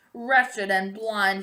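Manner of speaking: ordinary delivery, nothing marked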